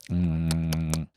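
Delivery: long, low "mm" of food pleasure; satisfied hum. Shown as a demonstration. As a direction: hum low as if savoring a sweet. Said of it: "the sound I make after I eat".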